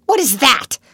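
sharp, loud angry demand; angry exclamation. A narrator's reading. Governess discovering a hidden maid, angry. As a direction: snap the question loud and high. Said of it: "she exclaimed angrily".

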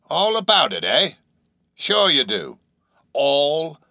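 high-pitched, doubting questions; sceptical old sailor. A narrator's reading. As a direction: query it like a knowing old salt. Stranger doubts the claim.

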